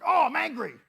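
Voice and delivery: high, loud burst of hammed-up anger; acted knee-jerk outburst; mock anger. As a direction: blurt it out in a mock rage. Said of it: "That's what anger is. We're just like".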